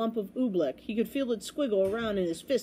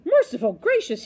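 louder, higher startled exclamation; gulped exclamation; 4 semitones higher, 7 dB louder than usual. A narrator's reading. Bell ringer sees the oobleck, gulped in shock. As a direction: gulp it in shock. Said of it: "he gulped".

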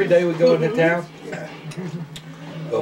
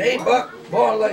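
raised, higher call across the street; 6 semitones higher, slower than usual. Retold or imitated. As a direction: hail him from the store door. Storekeeper calling out to Buck.

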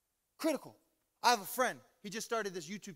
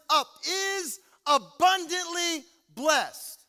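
loud, high, slow proclamation; forceful preaching; 6 semitones higher, 9 dB louder, slower than usual. Real, not acted. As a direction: preach each phrase out with building force. Preaches scripture at full force.

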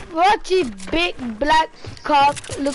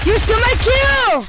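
angry yell; 7 semitones higher, 4 dB louder than usual. Real, not acted. Yelling at teammate over kill.

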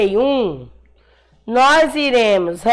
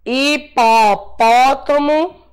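each word drawn out slowly for the class; slow dictation; much slower than usual. Shown as a demonstration. Teacher dictates words slowly syllable by syllable. (ditado lento, palavra por palavra)

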